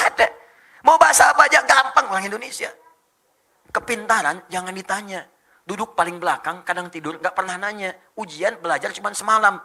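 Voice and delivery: usual speaking voice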